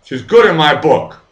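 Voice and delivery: loud, high, heated yelling; furious shout. Real, not acted. Streamer defending Elena to viewers, furious yelling. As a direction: shout them down, defending a friend.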